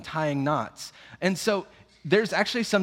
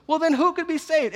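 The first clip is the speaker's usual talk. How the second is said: alarmed disbelief; 13 semitones higher, 4 dB louder than usual. Retold or imitated. Disciples shocked by Jesus' saying.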